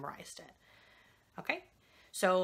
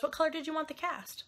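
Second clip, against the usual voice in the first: higher, chirpy mock nurse voice; mimicking the nurse; 9 semitones higher than usual. Mother mimicking the cheery nurse at the desk, higher. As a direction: parody the nurse's casual question. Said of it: "And she goes".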